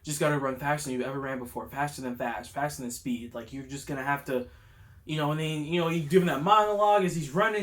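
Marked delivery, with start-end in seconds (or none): 0.0-4.5 soft, low inner pep talk; quiet determination. Retold or imitated. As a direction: murmur it like a hero steeling himself. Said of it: "This boy was like".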